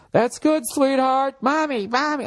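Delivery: high, sugary mom voice; doting mom. Retold or imitated. Mother praising her kid at the pool, high coo. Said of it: "listen to them with their kids".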